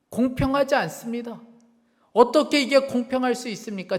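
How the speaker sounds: anguished protest. Retold or imitated. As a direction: argue back at God, refusing. Protesting God, refusing to write.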